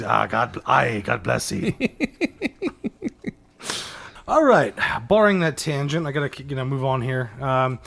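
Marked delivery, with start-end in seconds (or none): 0.0-1.7 put-on low gruff old sailor voice; a touch of old sea captain. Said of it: "Him, he's". 1.8-3.3 giggling fit; breathy high laugh. Real, not acted. Laughing at the bit.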